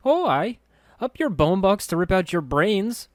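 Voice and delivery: high, loud mocking threat; threatening girl voice. A narrator's reading. Anna sneering a threat at Mort, high and loud. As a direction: scare him off with a sneering threat.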